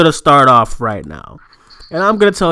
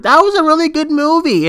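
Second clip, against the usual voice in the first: dumb moviegoer voice; 10 semitones higher than usual. Retold or imitated.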